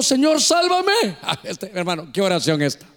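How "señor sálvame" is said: slow, higher plea of a sinking man; cry for help. Retold or imitated. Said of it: "empezando a hundirse gritó". (Pedro hundiéndose, grito lento de auxilio)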